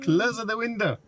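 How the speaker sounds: high-pitched mock Spanish accent, mistaken for Italian; hammed-up Spanish accent. Shown as a demonstration. Retrying the window command on the car, hammed-up Spanish accent. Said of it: "try this in a Spanish accent".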